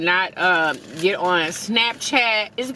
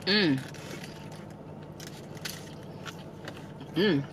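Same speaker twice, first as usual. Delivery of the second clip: chewing while humming; muffled closed-mouth mm; mouth full of burger; 4 semitones lower, 12 dB quieter than usual. Real, not acted. Seen 1: Bites burger, then chews with a full mouth through the mms.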